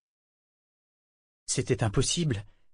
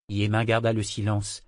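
ordinary delivery

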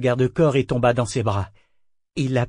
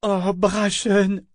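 high, slow, overcome sigh of a name; shivering emotion; 9 semitones higher, 3 dB louder, much slower than usual. A narrator's reading. Althea against Brachen's chest, shivering with emotion. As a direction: say it trembling in their arms. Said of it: "dit Althea toute frissonnante sur sa poitrine".

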